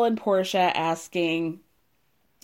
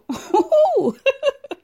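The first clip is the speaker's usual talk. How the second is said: high shrieking burst of laughter; big laugh; 6 dB louder than usual. Real, not acted. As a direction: cackle out loud, delighted. Howling at Danielle's comeback.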